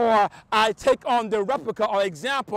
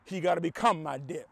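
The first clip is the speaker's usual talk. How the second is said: ordinary talk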